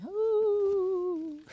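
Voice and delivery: high, long ghostly woo. Real, not acted. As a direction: hoot it out like a ghost.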